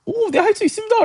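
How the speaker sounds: very high, naive eager reply; mock an overkeen yes, pitch way up; overeager disciples. Disciples answering Jesus's challenge, comically eager high voice.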